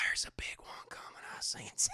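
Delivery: whispered, hushed prophecy; mock mystic whisper. Bill sensing the tornado, mock mystic whisper. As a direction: breathe it like a storm seer.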